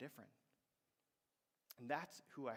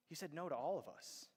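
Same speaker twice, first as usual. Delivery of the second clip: ordinary delivery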